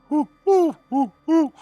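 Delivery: high, loud owl hoots; owlbear hooting. Retold or imitated. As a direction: hoot like an owlbear. Owlbears calling out.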